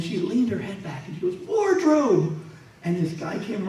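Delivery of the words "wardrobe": high-pitched call across the set. Retold or imitated. Actress leaning back to summon wardrobe, calling out. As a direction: sing out high for someone off set.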